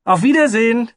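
very high, loud farewell shout; calling out. Acted. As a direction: shout goodbye out the window. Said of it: "und rief".

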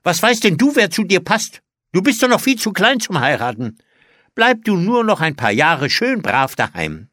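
high, loud scolding; streng. A narrator's reading. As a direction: put the child down sternly. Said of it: "sagte die Tante streng". (streng schimpfend)

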